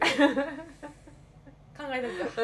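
slow, drawn out with a laugh; teasing drawl through laughter. Real, not acted. Teasing reply while laughing.

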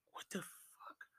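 voiceless, baffled curse; whispered shock. Retold or imitated. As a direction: whisper it in private disbelief. Acting out private shock under covers.

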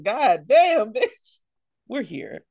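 ordinary delivery

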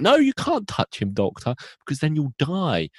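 high, urgent put-on girl voice; Nancy. Nancy warning the Doctor not to touch him, high mock alarm. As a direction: start high and loud, easing down as you warn. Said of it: "she talks like this".

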